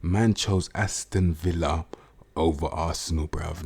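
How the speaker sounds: slow, deliberate, every word spaced out; slow, heavy emphasis on each word; said slowly. Shown as a demonstration. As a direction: spell it out slowly so it sinks in. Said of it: "I'll say it slowly".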